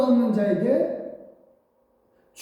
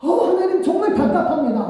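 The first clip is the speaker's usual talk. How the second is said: higher, quick frustrated plea; exasperated complaint; 7 semitones higher, faster than usual. Acted. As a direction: blurt out the frustration. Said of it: "라고 하는 겁니다".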